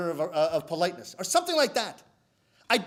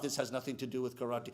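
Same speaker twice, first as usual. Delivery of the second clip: low, very soft grumble to oneself; quiet, dejected complaint under the breath; grumbling aside; 10 semitones lower, 10 dB quieter than usual. Acted. Student doing the chore for a day, low muted grumbling.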